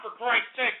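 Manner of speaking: normal talking voice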